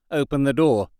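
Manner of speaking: ordinary delivery, nothing marked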